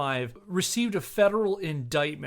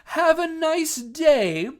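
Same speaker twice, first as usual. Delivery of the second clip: much higher, slow, sugary; mock sweet farewell; 12 semitones higher, 8 dB louder, slower than usual. Mocking the referee's cheerful send-off, slow and sugary. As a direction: parody the referee's fake friendliness. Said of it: "smiling and saying".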